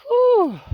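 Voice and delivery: high whistling whew; loud whew. Real, not acted. Gardener done covering everything in plastic, loud whew.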